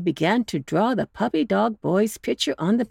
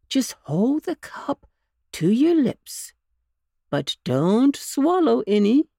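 higher, sweetly coaxing bunny uncle; lightly voiced coaxing uncle; coaxing old rabbit; 7 semitones higher, 4 dB louder than usual. A narrator's reading. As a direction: win the pup over gently. Uncle Wiggily instructing.

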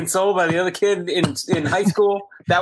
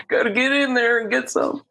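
hammed-up comic old-man voice; Bill Cosby impression; 9 semitones higher than usual. Continuing the Cosby bit.

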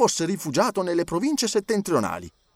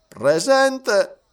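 Passepartout answering; 7 semitones higher, 5 dB louder than usual. A narrator's reading. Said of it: "rispose subito Passepartout".

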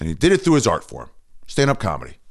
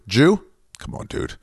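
ordinary talk, nothing marked

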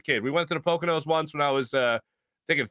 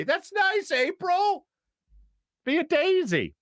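high, sugary, mocking sweetness; sweet high voice; 14 semitones higher, slower than usual. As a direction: coo it like a kindly nanny. Mock reply to daisy comment.

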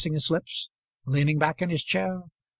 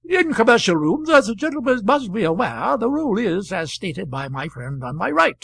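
higher, louder speechifying voice; orating Gape; 6 semitones higher, 6 dB louder than usual. Acted. Gape explaining the table rule to the stranger, orating. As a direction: hold forth like a club chairman.

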